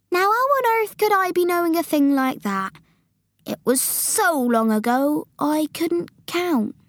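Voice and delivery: high, incredulous giant, slowing down. Acted. Giant asked his age by Sophie, exasperated. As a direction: start high, then draw it out.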